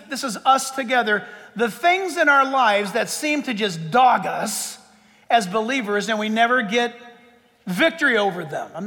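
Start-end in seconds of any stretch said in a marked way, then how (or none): none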